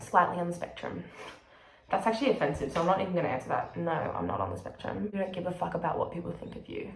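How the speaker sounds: usual speaking voice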